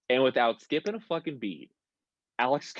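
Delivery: ordinary talk, nothing marked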